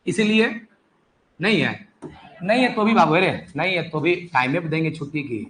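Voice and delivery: ordinary delivery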